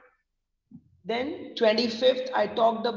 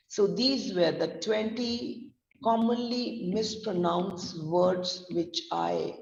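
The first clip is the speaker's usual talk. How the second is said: ordinary delivery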